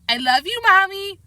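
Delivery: put-on lightly sweet child coaxing; sweet talk. Boy sweet-talks angry mother, sweet voice. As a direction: coax mother with a sweet little voice. Fudge sweet-talking Mom.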